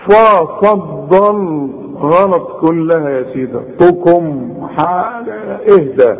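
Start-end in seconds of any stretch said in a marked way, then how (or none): 0.1-1.7 ففضل slowed, mocking the broken-up mistake; hammed-up faulty reading of فضلتكم; mock wrong reading. Shown as a demonstration. Teacher mimics a student's fragmented reading. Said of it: "ما تقولش".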